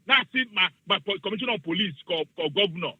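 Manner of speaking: ordinary talk, nothing marked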